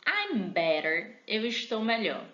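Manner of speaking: ordinary talk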